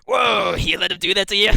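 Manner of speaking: loud high teasing call; mocking shout. [name] loudly teases the archer for losing to a halfling. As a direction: laugh at your beaten partner.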